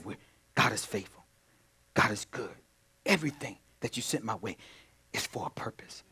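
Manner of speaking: soft, low, reverent murmur; hushed prayerful voice. Shown as a demonstration. Preacher shows how the faithful speak to God, hushed and reverent. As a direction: pray it quietly, almost to yourself. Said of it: "they speak like this".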